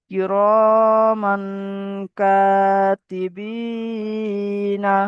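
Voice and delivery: very slow chanted কিরমান কাতিবিনা, each syllable drawn out; slow Quran recitation. Shown as a demonstration. Teacher leading students in slow recitation. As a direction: recite it slowly for the class to repeat. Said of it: "বলুন আমার সঙ্গে।".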